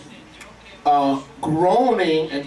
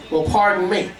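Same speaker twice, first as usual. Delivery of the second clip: ordinary talk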